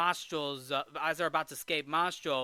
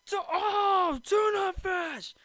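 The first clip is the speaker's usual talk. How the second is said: high, loud, drawn-out mock cry of delight; hammed-up joyful shout; excited Geppetto; 4 dB louder, much slower than usual. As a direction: cry out as a hungry man spotting food. Geppetto sees food, acted.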